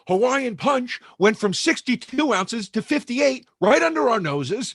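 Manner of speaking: mock-earnest, high-pitched retelling of a grave complaint; dead serious. Guest quoting a co-host on shrinking food products, mock dead serious. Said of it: "And dead serious, he goes".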